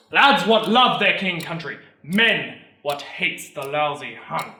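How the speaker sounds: loud opening, emphatic recruiting pitch; military orator making his pitch; sergeant major, calling for volunteers. Acted. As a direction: call the brave lads forward.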